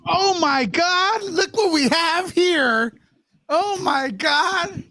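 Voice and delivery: slow, high amazed gasp; drawn-out awe. Acted. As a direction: stretch it out in wonder. Greets the guest with mock awe.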